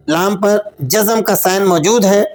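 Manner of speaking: ordinary delivery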